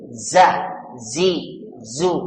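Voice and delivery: Za, zi, zu with a clear buzzing z; z sound drill on za zi zu. Shown as a demonstration. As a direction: sound out Za, zi, zu slowly for students. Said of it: "ini pakai zai".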